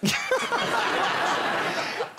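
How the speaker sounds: high, breathy burst of laughter; laughing. Real, not acted. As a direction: laugh out loud at the joke. Laughing at tour title.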